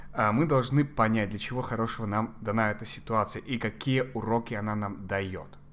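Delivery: ordinary talk, nothing marked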